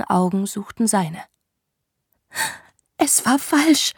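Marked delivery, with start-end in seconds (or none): 3.0-3.9 higher, croaking and strained; croaked. Acted. Woman confessing to the man, croaking and high. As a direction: croak it out, voice breaking. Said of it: "krächzte sie".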